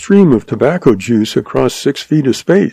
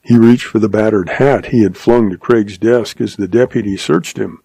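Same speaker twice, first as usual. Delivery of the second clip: ordinary delivery, nothing marked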